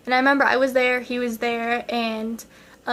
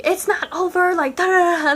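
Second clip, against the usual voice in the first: high-pitched mock tantrum; retold tantrum; 6 semitones higher, 4 dB louder than usual. Girl refusing a breakup, high mock tantrum. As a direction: protest in a high, childish fit. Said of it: "during my tantrum".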